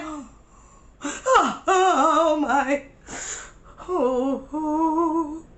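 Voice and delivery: gasping, wailed ohs; hammed-up sobbing as if about to faint; mock crying breakdown. Retelling her breakdown when phone died, mock crying. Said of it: "I broke down and I cried".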